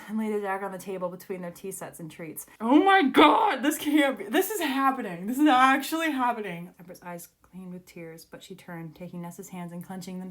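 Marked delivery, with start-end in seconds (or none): 2.6-6.7 loud, high outburst easing slightly; excited disbelief. Real, not acted. Reader seeing the meeting actually happen, excited disbelief. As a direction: gush as if it can't be real. Disbelieving joy at the book scene.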